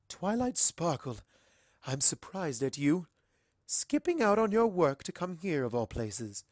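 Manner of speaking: lightly voiced stately ruler; composed, mildly disapproving; regal princess reproving. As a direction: gently chide a pupil, measured at first. Princess Celestia gently criticises Twilight.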